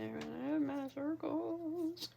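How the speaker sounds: slow, stretched-out words rising in pitch; drawn-out. Real, not acted. Crafter telling viewers about their circles, drawn-out. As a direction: draw it out, climbing to the end.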